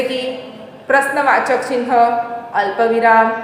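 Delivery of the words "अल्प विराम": ordinary talk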